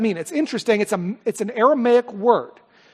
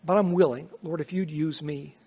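soft, low spoken prayer; hushed, humble prayer to God; closing prayer; 4 semitones lower, 6 dB quieter than usual. Real, not acted. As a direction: pray quietly and humbly before the congregation.

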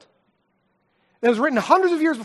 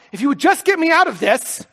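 louder, higher pleading prayer; pleading to God; 6 semitones higher, 7 dB louder than usual. Retold or imitated. Father retells begging God in the hospital, loud and pleading. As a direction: beg God to get you out. His prayer voiced in retold scene.

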